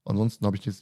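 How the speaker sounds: ordinary talk, nothing marked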